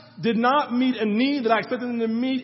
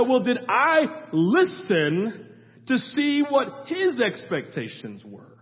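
reflective self-question; slower than usual. Acted. Self-questioning before God.

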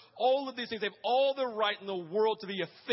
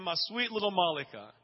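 ordinary delivery

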